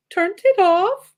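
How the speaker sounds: high, loud, drawn-out Irish mimicry; mimicked Irish accent. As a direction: kids parroting an Irish accent as a question. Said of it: "saying something back to me in my accent".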